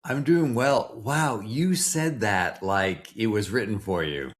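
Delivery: usual speaking voice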